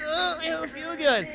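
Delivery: high-pitched mock groaning voice; feigned sickness. Streamer voices Anakin after being trampled, feigned sickness. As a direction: play hurt, pitched well up.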